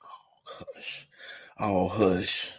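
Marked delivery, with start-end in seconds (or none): 0.0-1.1 whisper. Acted. Whispered self-hush.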